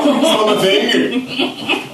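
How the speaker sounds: high, very slow laughing Smell my finger; laughing, drawn out. Retold or imitated. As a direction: stretch it out while cracking up. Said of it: "repeated, stretched and laughing".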